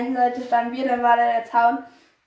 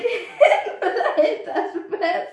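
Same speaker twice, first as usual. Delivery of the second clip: high, slow words through giggles; laughing while telling; 7 semitones higher, much slower than usual. Real, not acted. Friend retelling how the other grabbed the fence, cracking up. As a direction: struggle to finish through laughter.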